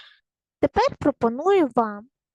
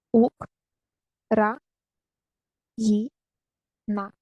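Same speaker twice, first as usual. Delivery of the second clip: ук, ра, ї, на, each syllable said separately with a pause; syllables split apart; slower than usual. Shown as a demonstration. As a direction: break Україна into ук-ра-ї-на. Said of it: "Це склади". (по складах, з паузами)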